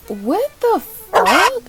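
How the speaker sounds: shocked. Real, not acted. Vlogger startled by a character, high shocked blurt. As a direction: blurt it out slowly in disbelief.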